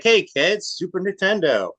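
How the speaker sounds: stepdad announcing a gift. Retold or imitated. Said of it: "he's like".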